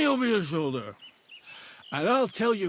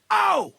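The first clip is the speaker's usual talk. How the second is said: loud high cry of pain; sharp yelp of pain; 12 semitones higher, 8 dB louder than usual. Acted. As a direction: yelp as the foot twists. Injured man yelps.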